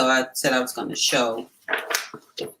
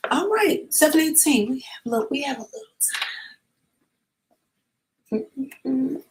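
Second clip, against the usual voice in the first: eager; 10 semitones higher than usual. Real, not acted. Excited about the next book.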